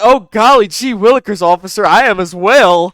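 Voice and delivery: loud, high goody-two-shoes mimicry; hammed-up mock politeness, pushed loud; mocking imitation. Commentator parroting a man talking to an officer, mocking. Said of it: "He said".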